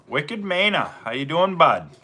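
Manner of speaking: playful put-on Maine accent; a light Maine accent for the greeting. Shown as a demonstration. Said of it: "I can have that Wicked Maine accent".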